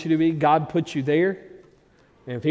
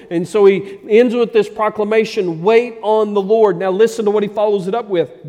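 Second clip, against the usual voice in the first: loud, raised sermon voice; full-force preaching; 8 semitones higher, 9 dB louder than usual. Real, not acted. Preacher voicing the psalmist's resolve to his congregation, full-force preaching. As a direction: preach it hard with driving stresses.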